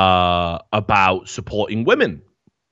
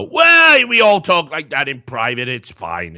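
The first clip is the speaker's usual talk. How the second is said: mock dismissive excuse; 4 semitones higher, 4 dB louder than usual. Host mimics voters defending their candidate, loudly mock-dismissive. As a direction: start high and loud, waving it away. You would go.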